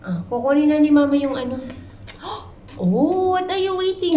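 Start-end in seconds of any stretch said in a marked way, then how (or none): 1.6-2.7 sharp unvoiced gasp; surprised gasp. Real, not acted. Mother surprised by toddler. 2.8-4.2 high, loud surprised question. Real, not acted. Mother surprised at toddler.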